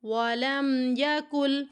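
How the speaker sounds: very slow chanted قل هو اللہ with drawn vowels; Quran recitation. Shown as a demonstration. Teacher recites slowly so students read along. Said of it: "کیسے پڑھیں گی؟".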